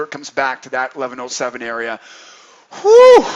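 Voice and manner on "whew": high, loud "whew". Real, not acted. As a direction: let out a big, high whew. Exhales after long rundown.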